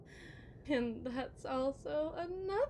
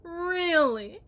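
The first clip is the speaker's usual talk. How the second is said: mock disbelief; 4 semitones higher, 13 dB louder than usual. Wife mocking husband.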